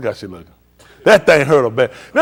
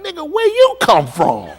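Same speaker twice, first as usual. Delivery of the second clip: much higher, slow, laughing disbelief; mock amazement; 13 semitones higher, slower than usual. Old man asks photo of younger self, high and laughing. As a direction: marvel at his younger self.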